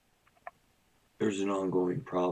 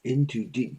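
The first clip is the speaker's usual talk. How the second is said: normal talking voice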